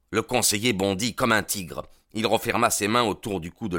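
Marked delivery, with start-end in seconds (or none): none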